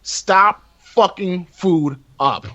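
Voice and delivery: slow, drawn-out appeal; mock solemn plea. Host begging vegans to stop, mock solemn plea. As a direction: plead slowly, stretching each phrase.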